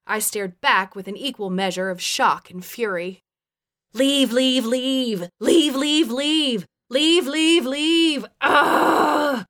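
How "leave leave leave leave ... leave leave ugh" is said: loud, high, rhythmic chant; climbing inner scream ending in a roar; hammering loudly. A narrator's reading. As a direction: hammer each word out in triplets. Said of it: "Hammered on loudly in my head".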